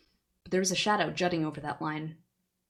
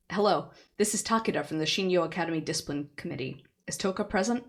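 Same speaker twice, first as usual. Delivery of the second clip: ordinary delivery, nothing marked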